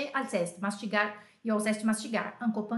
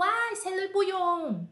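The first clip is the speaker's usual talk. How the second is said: put-on high, slow cry of a kid; kid shouting; 9 semitones higher, slower than usual. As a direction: blurt it out like a scared pupil. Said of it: "alguém gritou". (grito agudo e lento de aluno)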